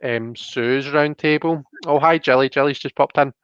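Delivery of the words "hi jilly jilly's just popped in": ordinary delivery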